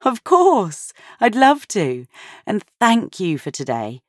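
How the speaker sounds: a hint of a tired, cheerful mum; Sophia's voice. A narrator's reading.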